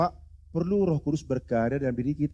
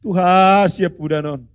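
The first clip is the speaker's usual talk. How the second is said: high, much louder call to God; sudden loud appeal from the pulpit; loud outcry; 7 semitones higher, 14 dB louder, slower than usual. Real, not acted. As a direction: cry out to the Lord. Preacher crying out.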